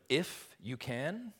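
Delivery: IF drawn out slowly, "you can" lifted high as a challenge; slow, pointed echo of "If you can"; incredulous exclamation. Acted. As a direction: throw "If you can" back as a slow, rising question. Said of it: "Jesus replies with an exclamation".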